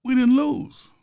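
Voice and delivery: loud high mock denial; mock insistent shout. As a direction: yell it at the umpire. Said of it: "goes up to the umpire and says".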